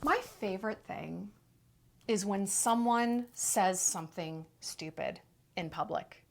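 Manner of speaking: hushed low monotone; savoring hush. Acted.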